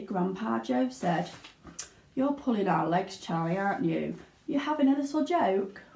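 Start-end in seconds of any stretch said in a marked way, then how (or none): none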